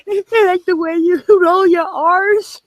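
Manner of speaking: giggling through the words; laughing. Real, not acted.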